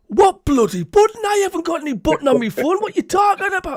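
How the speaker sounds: high, loud exasperated outburst; Big Davey. Retold or imitated. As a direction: rant like a caller baffled by technology. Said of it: "annoyed 'cause he wanted to be on".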